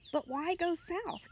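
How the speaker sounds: high, slow plaintive question; crying out. A narrator's reading. Lamb asks the leaving butterfly, high and plaintive. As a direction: ask it high and slow, dismayed. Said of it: "cried the lamb".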